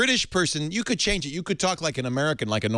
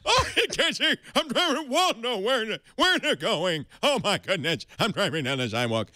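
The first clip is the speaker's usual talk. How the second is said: befuddled near-blind driver fussing at the wheel; Mister Magoo; 6 semitones higher than usual. Acted. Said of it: "But if you're Mister Magoo".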